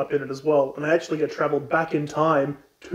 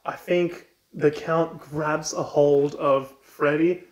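ordinary delivery

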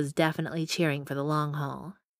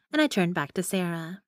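plain reading voice

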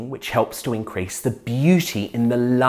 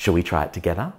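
ordinary talk

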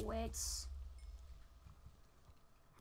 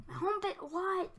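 higher, louder disbelief; real shocked cry; 8 semitones higher, 7 dB louder than usual. Real, not acted. Player finding his home pit invaded, shocked. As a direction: blurt out in shock.

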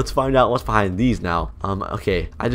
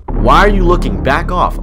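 loud, higher-pitched confrontational read; aggressive quote; 5 semitones higher, 7 dB louder than usual. Retold or imitated. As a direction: act out someone snapping at an intruder. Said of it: "this guy just said".

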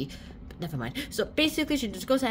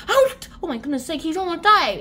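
loud, high alarmed outcry; mock panic; 8 semitones higher, 8 dB louder than usual. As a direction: cry out in exaggerated alarm. Acted panic at the fall.

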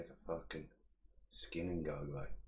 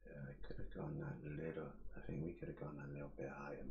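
whispered; 3 semitones lower, 8 dB quieter than usual. Real, not acted. Muttering to himself.

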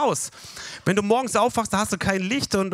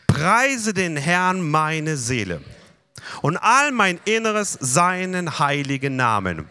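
preacher leads the church through Psalm 103, reciting slowly; slow recitation; slower than usual. Shown as a demonstration. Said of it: "Alle zusammen".

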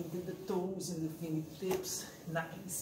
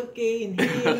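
calling out the breath cue loudly; loud breathing coach; loud instructor call; 5 semitones higher, 14 dB louder than usual. Retold or imitated. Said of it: "I just say".

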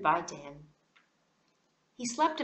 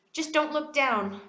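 usual speaking voice

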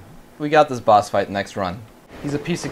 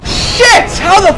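startled high cry, dragging out the last words; sudden shocked outburst; shocked yelp; 8 dB louder, much slower than usual. Real, not acted. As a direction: caught off guard by a hit. Sudden death in fight.

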